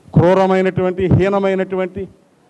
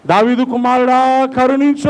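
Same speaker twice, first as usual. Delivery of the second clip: crying out; 7 semitones higher, 4 dB louder than usual. Acted. Preacher calls out like Bartimaeus begging mercy, crying out. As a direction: call out high for mercy. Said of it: "అని భర్తిమయ్యేలా కేకలేస్తాం".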